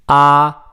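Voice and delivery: AH high, loud and level; high flat tone. Shown as a demonstration. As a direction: hold AH on a high, even pitch. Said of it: "are the four tones".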